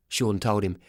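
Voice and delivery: plain reading voice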